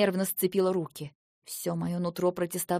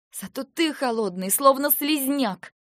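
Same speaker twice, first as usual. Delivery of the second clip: high, louder, slow disgusted jab; unrestrained retort; 6 semitones higher, 4 dB louder, slower than usual. Acted. Wife loses control and insults husband, high and loud. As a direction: spit out the insult. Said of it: "не сдержалась я".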